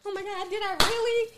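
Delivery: high, loud, alarmed disbelief; mock panic. Woman checking the bed in panic, acted alarm. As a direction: gasp it out in a fake panic.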